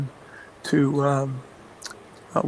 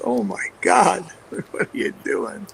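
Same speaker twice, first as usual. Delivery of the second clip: mock disbelief; 3 semitones higher than usual. Assistant shocked by play call.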